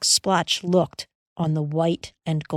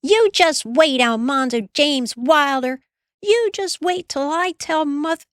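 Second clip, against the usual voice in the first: furious sister's high threat; an older sister threatening to tell Mother; cried out; 9 semitones higher than usual. A narrator's reading. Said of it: "Then she cried out".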